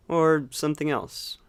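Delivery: calm meditation guide, long unhurried pauses; guided meditation. Acted. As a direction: gently guide them into watching the breath, leaving space between phrases. Leads a breathing meditation.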